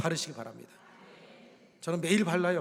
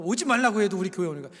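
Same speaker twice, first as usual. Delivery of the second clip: ordinary talk, nothing marked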